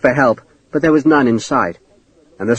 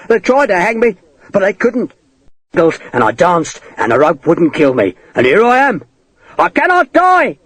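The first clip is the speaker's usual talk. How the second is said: high raving voice, climbing at the end; manic boasting; 5 semitones higher than usual. A narrator's reading. Cornered man defying the sword, manic high raving.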